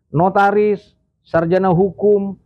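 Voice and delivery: ordinary talk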